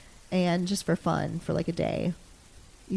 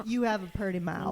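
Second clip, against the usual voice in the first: country bumpkin voice. Retold or imitated. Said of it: "he was kind of a country bumpkin".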